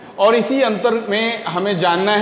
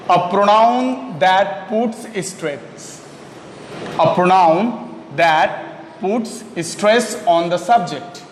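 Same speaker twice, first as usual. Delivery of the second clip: slow dictation; much slower than usual. Shown as a demonstration. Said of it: "teacher dictating definition slowly".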